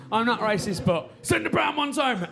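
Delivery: measured start bursting into a high outburst. Retold or imitated. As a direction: hold back, then blurt it out high. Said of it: "trying their best not to sound racist".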